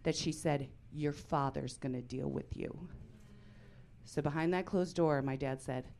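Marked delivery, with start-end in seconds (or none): 0.9-2.9 quiet threat. Retold or imitated. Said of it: "so shaking mad with me".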